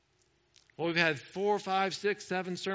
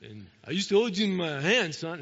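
bad Rocky impression; 2 semitones lower than usual. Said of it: "I can't, really can't do Rocky".

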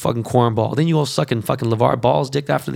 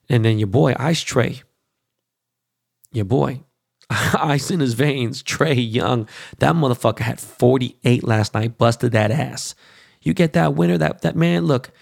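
normal talking voice

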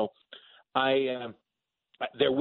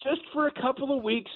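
line repeated much higher through laughter; laughing repeat; 12 semitones higher than usual. Real, not acted. Guest repeating his punchline to the host, laughing high. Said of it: "repeats own punchline".

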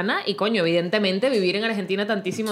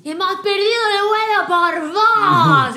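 high, drawn-out Argentine accusation; Argentine couple quarrel; 10 semitones higher, 4 dB louder, much slower than usual. Retold or imitated. Imitating Argentine spouses fighting over a lost flight, high Argentine quarrel. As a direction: wail it slowly in an Argentine accent. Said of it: "Se han armado un peo entre el marido y la mujer".

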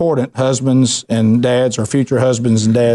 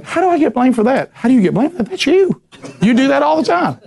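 indignant protest; 11 semitones higher than usual. Retold or imitated. Husband protesting wife's blame.

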